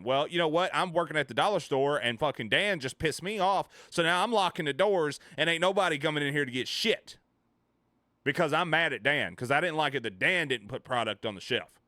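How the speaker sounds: loud, high-pitched hammed-up grievance; mock angry rant. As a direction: play a fed-up store worker venting loudly. Acts out angry dollar store worker.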